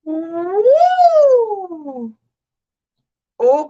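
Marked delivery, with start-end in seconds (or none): 0.1-2.2 long, high, loud whoop; hollering with delight. Real, not acted. Delighted reaction to grandmother comment.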